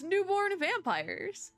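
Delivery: high, slow, wondering "Newborn vampires"; mock awed father. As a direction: drag it out in amazement. Father character's line read aloud.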